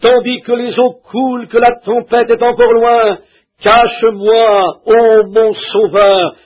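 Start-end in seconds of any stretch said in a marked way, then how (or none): 3.6-6.3 imploration lente. Real, not acted. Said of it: "permets que je me cache en toi".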